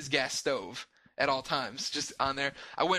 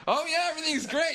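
high, sing-song forced cheer; fake cheerful; 13 semitones higher, 3 dB louder than usual. Lying to Katie on the phone, falsely cheerful. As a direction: lie brightly so she won't worry. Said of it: "I'm like".

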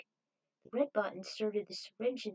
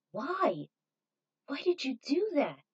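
louder, higher startled question; surprised demand; 6 semitones higher, 6 dB louder, slower than usual. Acted. Someone questions the Red Bot, startled. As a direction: ask in sudden disbelief.

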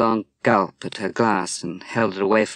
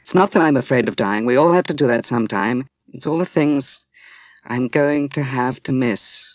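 louder, faster, bitter complaint; angry outburst; 4 semitones higher, 4 dB louder than usual. Acted. Woman to Dwight, angry she must miss everything. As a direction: let the anger spill out, voice pushed up. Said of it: "and then she said angrily".

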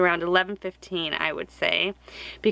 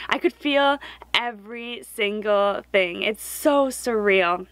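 ordinary talk, nothing marked

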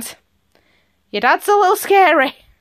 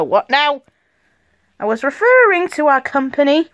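ordinary delivery

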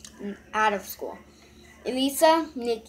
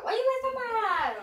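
mock sister; 13 semitones higher, faster than usual. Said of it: "She gonna be like".

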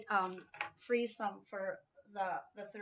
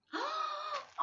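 high sudden gasp; sharp gasp of delight. Real, not acted. Gasp opening the gift she hoped for. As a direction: catch your breath in joy.

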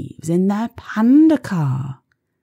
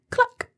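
a high hen cluck. Pretend. Hen sound imitated.

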